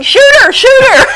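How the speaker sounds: playful mock yell at the hero; mock shout. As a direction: urge him on, half laughing. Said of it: "But I kept thinking".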